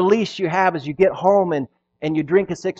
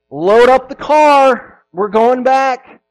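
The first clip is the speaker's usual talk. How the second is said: stern parent; 8 semitones higher, 9 dB louder, much slower than usual. Retold or imitated. Parent scolds kids over chapstick.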